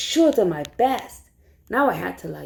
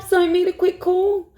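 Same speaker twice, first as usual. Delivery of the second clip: ordinary delivery, nothing marked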